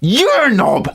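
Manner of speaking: high-pitched mock yell; playful, exasperated squawk; mock-exasperated outburst. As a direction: blurt the insult at an insufferable mate. Acted rebuke of pretentious mate.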